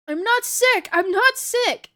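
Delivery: high, loud desperate denial; shouting back. A narrator's reading. Ellie protesting to Tess, desperate shout. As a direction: shout it, stretching the repeat. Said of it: "Ellie shouts back".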